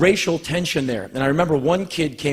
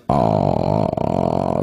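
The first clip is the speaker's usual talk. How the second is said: stomach groan. Retold or imitated. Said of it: "my stomach like".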